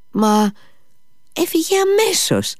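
very high-pitched granny voice; old woman. A narrator's reading. Old woman answers Mitya's startled question, high-pitched granny. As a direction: squeal it out as a little old woman.

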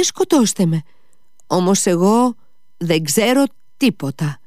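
put-on high-pitched, pleading young servant; frightened swearing. A narrator's reading. Fenya swears to Mitya she knows nothing, pleading. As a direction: protest innocence in a high, anxious voice. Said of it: "άρχισε να ορκίζεται και να σταυροκοπιέται".

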